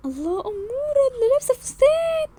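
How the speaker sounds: high, rising, soft coo; cooing admiration. Acted. Onlooker admires girl in a dress, cooing. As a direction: gush over her sweetly.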